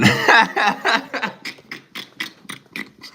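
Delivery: high, loud laugh trailing into wheezes; helpless laughter. Real, not acted. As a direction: laugh until the breath runs out. Cracks up at sudden appearance.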